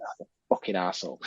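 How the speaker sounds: muttered insult. Retold or imitated. Retelling realising he was wound up, muttered under breath. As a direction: mutter it as the penny drops. Retold own reaction to wind-up.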